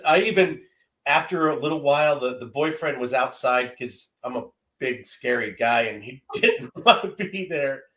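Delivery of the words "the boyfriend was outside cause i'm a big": normal talking voice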